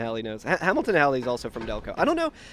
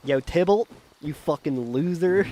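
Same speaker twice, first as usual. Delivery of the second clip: put-on Delco accent. Taunting Tybalt in mock Shakespeare, Delco accent. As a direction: heckle in a Philly-area Delco accent. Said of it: "say in a Delco accent".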